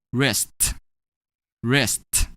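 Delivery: "wrist" for risked, ending in a released t. Shown as a demonstration. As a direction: finish "wrist" on a separate voiceless t. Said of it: "we're gonna pronounce it as".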